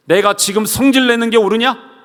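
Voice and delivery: high, loud rebuke; stern question. Retold or imitated. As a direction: challenge Jonah's anger. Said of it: "라고 지금 말씀하시는 것입니다". (요나를 꾸짖는 높은 목소리)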